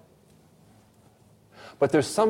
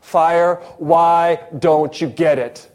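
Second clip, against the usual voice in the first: louder, drawn-out plea with x0.5 pace; pleading question; 3 semitones higher, 6 dB louder, much slower than usual. Acted. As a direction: stretch the question out, pressing him. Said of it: "here comes the second question".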